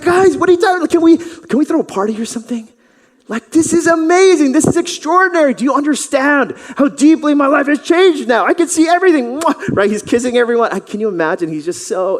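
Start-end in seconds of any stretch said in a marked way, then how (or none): 0.0-9.4 high, loud, gushing joy; celebrate, nearly shouting; ecstatic. Acted. Healed man asking neighbors for a party, ecstatic. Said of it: "he's like". 9.4-9.8 smacking kiss sound; loud kiss. Acted. Healed man kissing everyone, a loud smack.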